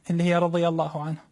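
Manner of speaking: ordinary delivery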